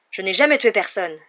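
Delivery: loud, indignant outcry; cry out. A narrator's reading. Young man denying the killing to Jedusor, crying out. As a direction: cry out the denial. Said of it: "s'écria le jeune homme".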